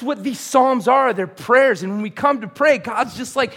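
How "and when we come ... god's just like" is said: ordinary talk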